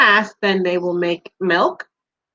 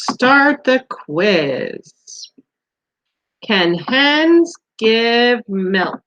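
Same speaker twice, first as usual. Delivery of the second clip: very slow, each word read out carefully; slow reading aloud; much slower than usual. Shown as a demonstration. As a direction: read it out slowly for small children. Reading quiz title to children.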